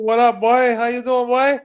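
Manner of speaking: high playful patois greeting; mock Jamaican patois; Jamaican accent. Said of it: "Let's hear a little, uh, patois".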